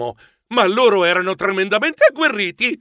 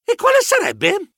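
high, curious squeak; puzzled question; 3 dB louder than usual. A narrator's reading. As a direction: ask cluelessly, high-pitched. Narratore chiede perplesso. (domanda acuta e perplessa)

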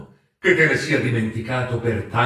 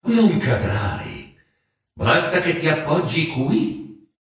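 slow, calm spirit voice; reassuring ghost; slower than usual. A narrator's reading. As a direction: gently soothe his fear.

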